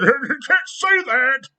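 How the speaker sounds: high, very slow prissy mock; mocking censor. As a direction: drawl it like a scolding prude.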